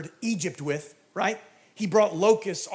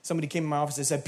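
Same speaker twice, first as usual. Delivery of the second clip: ordinary talk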